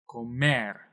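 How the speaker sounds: "Comer" with "mer" longer and stronger; teacher's demo of "Comer", stress at the end; stressed last syllable. Shown as a demonstration. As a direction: punch the final "mer" of "Comer". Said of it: "say that a little bit louder".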